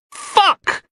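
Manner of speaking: very high sharp cry of pain. A narrator's reading. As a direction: cry out as the knife goes in. Stabbed in the kidney.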